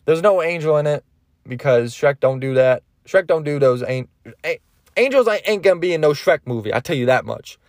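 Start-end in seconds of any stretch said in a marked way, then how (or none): none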